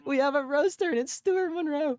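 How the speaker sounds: very high, gleeful announcement; delighted hype. Real, not acted. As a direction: present the caller like a star roaster. Host delighted at caller's insults.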